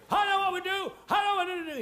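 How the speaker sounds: excited officer's sudden idea; excitable officer. Retold or imitated. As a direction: blurt it out like an overexcited officer. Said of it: "He's a very excitable man".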